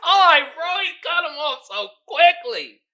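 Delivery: laughing through the words; cracking up while telling it. Real, not acted. Laughing at Kevin cutting people off.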